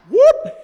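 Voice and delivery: high loud whoop of a gulp; gulp sound. Retold or imitated. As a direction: make the swallow noise. Gulping down the concoction.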